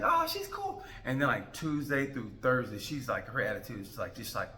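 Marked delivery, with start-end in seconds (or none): 0.0-0.8 much higher, airy mock-gushing voice. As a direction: squeak it out like a fan girl. Mimicking people praising hot chick.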